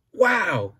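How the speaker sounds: amazed little gasp of wow; wow, really impressed. Acted. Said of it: "looking at the pie".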